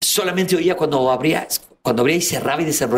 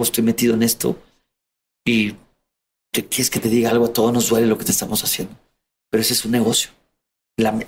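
remorseful captor; 6 semitones lower than usual. Retold or imitated. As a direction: apologize in his low, sorry tone. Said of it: "Y le dice". (un secuestrador arrepentido, voz apenas más grave)

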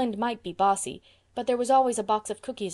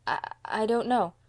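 stammered, uneasy admission; nervous; 2 semitones higher than usual. A narrator's reading. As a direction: stumble on the first word, cornered. Davy cornered by questioning.

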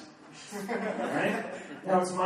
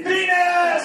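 high, loud yell out of nowhere; callback shout; 11 semitones higher, 6 dB louder than usual. Acted. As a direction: bark the game word as a punchline. Callback to the penis game.

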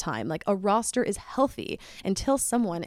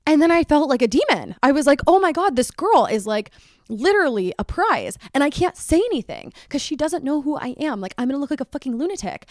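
much higher and louder, frantic; panicked alarm; 7 semitones higher, 4 dB louder than usual. Retold or imitated. Realizing her texts pulled him away, higher frantic guilty panic. Panicking she's the other girl.

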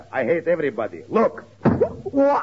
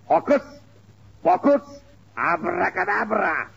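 magic incantation; 4 semitones higher than usual. Acted. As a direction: intone it like a stage magician. Casting a magic spell.

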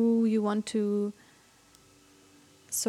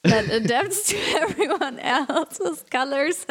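laughing; 5 semitones higher, 3 dB louder than usual. Real, not acted. Laughing at own word search.

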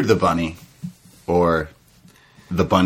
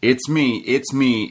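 normal talking voice

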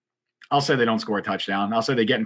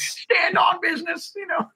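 very high, squeaky, slow nerdy voice; slower than usual. Shown as a demonstration. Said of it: "in that, like, nerdy voice".

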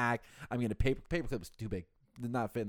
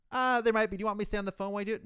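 high, fast eager agent voice; chipper phone rep; 9 semitones higher, 3 dB louder, faster than usual. Retold or imitated. Phone agent offering to stay on the line, high and fast. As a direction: rattle it off high and quick like a too-helpful rep.

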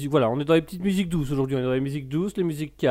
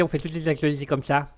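ordinary delivery

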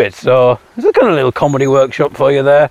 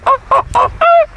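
high squeaky bird call; bird call imitation. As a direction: chirp it like the bird. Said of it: "Oh, I'll do a".

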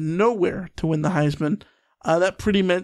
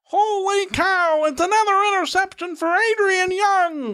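radio announcer; 10 semitones higher than usual. Pretend. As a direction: shout the play like an old-timey broadcaster. Mock radio call.

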